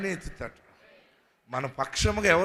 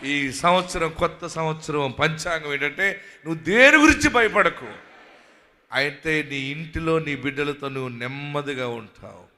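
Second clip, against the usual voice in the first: loud, rising preaching proclamation; full-force preaching; 8 dB louder than usual. Acted. Pastor declaring to the congregation, loud and fervent. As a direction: proclaim it over the crowd, building.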